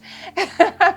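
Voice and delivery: bursting high laugh; a genuine laugh mid-story; real laugh. Real, not acted. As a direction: let a real laugh break out. Laughing while describing uncontrollable laughter.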